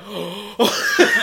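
sharp mock gasp; exaggerated gasp of alarm; imitated shocked gasp. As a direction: gasp as if caught out. Imitating Liam Neeson's shocked reaction.

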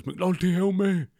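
loud high cry for help; high desperate plea. Retold or imitated. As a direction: beg from behind the blinds. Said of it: "he goes".

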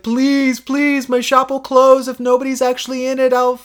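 high, mock-desperate pleading; hammed-up sob story begging him in. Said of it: "crying a whole river about it".

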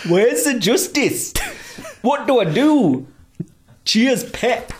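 drawn-out hammy Geordie plea; Geordie accent. Acted. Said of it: "should I do it in a Geordie accent?".

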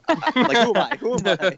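high excited squeal. Real, not acted. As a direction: squeal with fanboy glee. Screamed at Spider-Man, excited.

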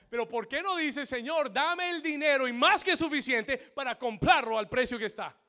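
high, loud proclamation; preacher quoting scripture at full power; full-force preaching. Acted. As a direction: thunder it out to the congregation.

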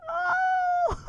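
very high, tearful-sounding long "oh"; joyful "oh". Retold or imitated. Hope scoops Sassy up, high overcome cry. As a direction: melt into a high wail of happiness.

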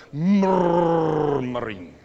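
loud, slow growled "MURRR-muring"; murmuring noise. Shown as a demonstration. Preacher imitating the noise of grumblers, drawn-out rumble. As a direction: stretch the first syllable of "murmuring" into a long rumble. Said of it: "It makes a noise".

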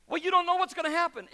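quick, high, pushy mock objection; doubting onlooker. Skeptic challenging the believer, quick high mock objection. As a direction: toss out the skeptic's challenge fast. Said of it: "they're going".

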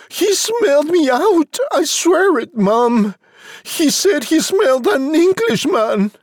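put-on high-pitched young Jack, protesting; urgent high child character; insistent frightened boy. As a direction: swear it is true in a high boy's voice. Jack insists to his mother.

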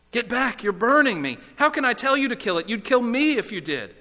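high-pitched protest at being burned; agitated ghost recoiling from pain; panicked ghost. Acted. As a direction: cry out in alarm and argue back.

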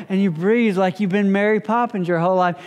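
ordinary talk

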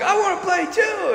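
put-on high small-kid ask; a tiny boy wanting in; little boy voice. Little brother asking to join, high childish voice.

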